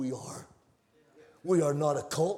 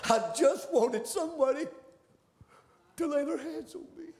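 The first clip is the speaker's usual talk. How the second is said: lightly voiced tearful woman; 10 semitones higher than usual. Voicing weeping woman's story.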